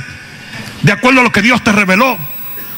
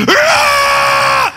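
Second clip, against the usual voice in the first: high-pitched scream of fright; sudden terrified yell; retold scream. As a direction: shriek like the dog just lunged. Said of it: "y yo hice".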